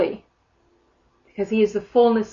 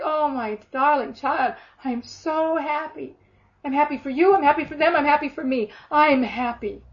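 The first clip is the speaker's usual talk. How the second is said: higher, tender joy, lingering on the opening; delighted loving father; 5 semitones higher than usual. Acted. God answering the darling child, overjoyed and tender. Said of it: "his heart's dancing when we do it".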